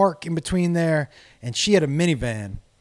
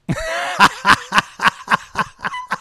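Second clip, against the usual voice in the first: loud high burst of laughter; real laugh; 4 dB louder than usual. Real, not acted. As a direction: crack up laughing.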